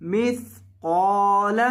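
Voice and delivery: मिस्काल slowly, heavy long कॉफ; drawn-out मिस्काल with a thick qaf. Shown as a demonstration. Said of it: "कॉफ को लंबा करें और मोटा करें". (मिस्काल, कॉफ मोटा और लंबा)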